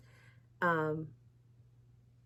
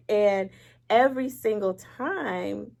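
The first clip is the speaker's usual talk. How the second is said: ordinary talk, nothing marked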